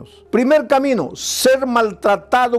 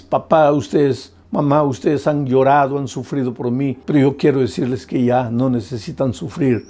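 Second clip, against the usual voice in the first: retold daughter's plea; 8 semitones lower than usual. Preacher voicing a daughter who comes home to her parents, a lightly voiced plea. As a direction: gently voice the daughter begging her parents to stop suffering.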